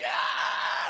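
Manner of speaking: harsh acted scream of agony; melting scream. Villain melting in the lava, agonised scream. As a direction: screech as if dissolving in lava.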